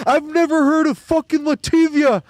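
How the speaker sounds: high loud New York yell, Latvia said luh-TIV-ee-uh; New York mispronunciation. Retold or imitated. Streamer mimicking the fan's take on Latvia. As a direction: shout it like the fan, luh-TIV-ee-uh. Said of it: "this is how he says it".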